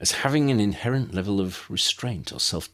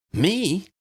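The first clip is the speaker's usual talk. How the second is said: much higher, louder surprised question; 13 semitones higher, 4 dB louder than usual. A narrator's reading. Lydia surprised to be chosen, high and loud. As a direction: gasp it, astonished.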